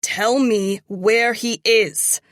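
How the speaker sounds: slow, loud menacing demand. Acted. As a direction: drag it out as a threat. Interrogation, demand repeated.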